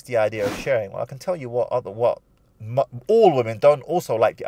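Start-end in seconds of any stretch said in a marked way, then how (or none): none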